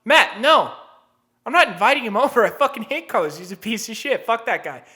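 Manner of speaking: hammed-up loud rant away from the call; loud aside. Role-played shout at roommate.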